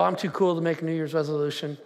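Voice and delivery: low, dismissive imitation; mock too-cool skeptic. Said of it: "some of y'all go".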